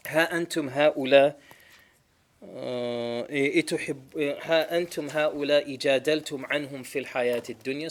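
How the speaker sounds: fast, rising chanted recitation; Quran recitation. Acted.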